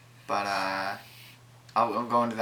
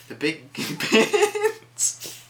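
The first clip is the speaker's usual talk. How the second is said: laughing; 11 semitones higher, 4 dB louder, much slower than usual. Real, not acted. Laughing at oversized pins.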